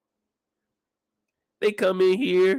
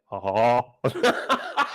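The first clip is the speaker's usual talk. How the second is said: mock shy laugh; 10 semitones lower, much slower than usual.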